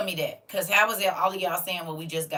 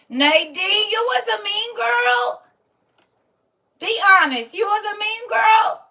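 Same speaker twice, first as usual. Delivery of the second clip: loud, high mock accusation; teasing shout; 13 semitones higher, 11 dB louder than usual. Teasing a student about being a mean girl, loud mock accusation. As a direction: call her out loudly in fun.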